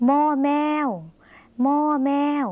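มอแมว called out high and bright, each syllable clear; letter name called out clearly. Shown as a demonstration. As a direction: announce มอแมว up high for the class. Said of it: "สะกดด้วย".

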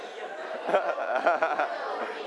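soft amused chuckle; laugh. Real, not acted. Teacher after students try to flip it, chuckling. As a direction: chuckle at the failed trick.

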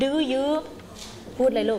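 "Do you" spaced out slowly for repeating; slow model. Shown as a demonstration. Teacher slowing a pupil down, "Do you" modelled slowly. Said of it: "ช้าๆ ช้าๆ".

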